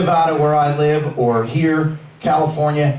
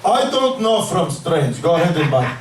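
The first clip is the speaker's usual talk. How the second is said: put-on Russian accent. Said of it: "Russian dispensary owner".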